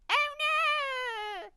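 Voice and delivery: put-on high, loud old witch cry. Old witch cries out as the kitten soothes her. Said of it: "she cried".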